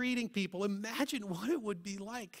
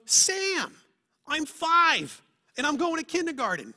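put-on much higher and louder, a child announcing himself; proud little kid; 7 semitones higher, 9 dB louder than usual. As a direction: brag like a five-year-old.

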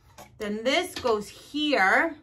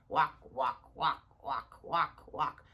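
little walking voice; 10 semitones lower, 8 dB quieter, slower than usual. Pretend. Legs pretend to walk.

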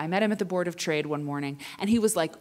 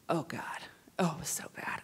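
whisper; 2 semitones lower, 8 dB quieter than usual. Real, not acted. Dreading memory of New York.

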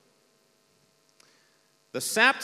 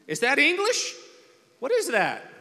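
baffled translator; 10 semitones higher, 4 dB louder than usual. Retold or imitated. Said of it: "here's a Chinese national, like".

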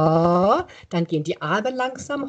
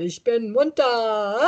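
slow and giggling; laughing yoga chant; 3 semitones higher, slower than usual. Shown as a demonstration. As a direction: laugh through the words.

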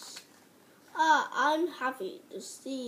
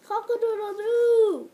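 loud high crowing; rooster crow; 7 semitones higher, 8 dB louder than usual. Acted. Rooster crows at morning.

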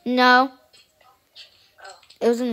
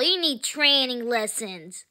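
ordinary talk

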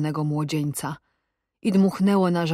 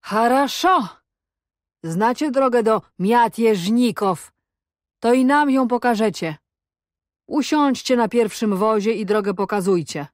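higher, measured taunting orders; deliberate menace; 4 semitones higher, 3 dB louder than usual. A narrator's reading. Cossack orders captive to show the way, deliberate taunt. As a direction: hand down the order with mocking relish. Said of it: "słowa powoli ze świstem z ust".

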